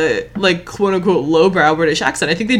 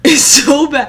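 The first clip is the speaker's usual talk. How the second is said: slow, louder groan through laughter; laughing; 7 semitones higher, 4 dB louder, slower than usual. Real, not acted. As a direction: laugh while condemning it. Laughing at the bad accent.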